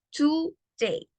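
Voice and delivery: "Today" with the o said as u, "tu-dei", split in two; pronunciation of "Today". Shown as a demonstration. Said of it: "la O se pronuncia como una U".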